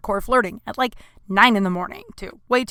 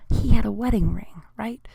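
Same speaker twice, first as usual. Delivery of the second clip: hushed whispered aside to the audience. Real, not acted. Comic telling the crowd a secret, whispered. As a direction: lean in and whisper it. Aside about the wedding ring.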